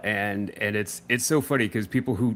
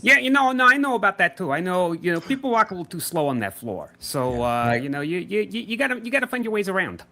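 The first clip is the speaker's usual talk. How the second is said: higher Toronto-tinged McFarlane voice; Todd McFarlane voice; 7 semitones higher than usual. Retold or imitated. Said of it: "Todd McFarlane sounds like this".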